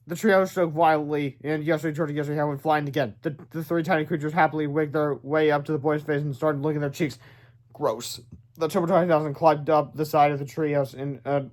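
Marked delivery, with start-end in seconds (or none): none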